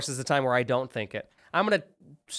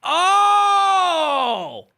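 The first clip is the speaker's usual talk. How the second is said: surprised shout; 8 dB louder than usual. Real, not acted. Friend mentions Clone High.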